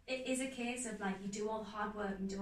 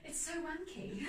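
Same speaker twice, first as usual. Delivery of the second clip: mock posh English accent; 7 semitones higher than usual. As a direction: play the posh English snob through the giggles. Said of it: "Please say that in the posh accent".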